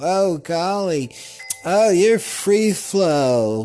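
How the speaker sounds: high mock-swooning exclaim; high falsetto. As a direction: squeal it up high, slow. Mock high exclaim at own phrase.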